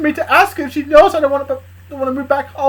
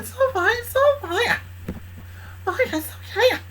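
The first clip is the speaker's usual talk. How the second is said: Luna's voice; 9 semitones higher, 6 dB quieter than usual. Acted. Luna comforting Charlie.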